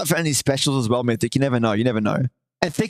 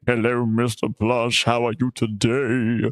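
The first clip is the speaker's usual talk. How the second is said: puppet voice; 5 semitones lower, slower than usual. Pretend. Puppet answers back.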